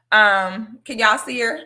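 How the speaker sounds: usual speaking voice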